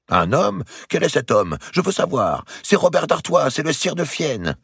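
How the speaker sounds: hammed-up jealous fury, pitched high; mock anger. Queen parodying her lover's jealousy, in mock anger. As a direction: mimic his jealous rage for fun. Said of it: "Et grossissant la voix, elle s'amusa à contrefaire la colère".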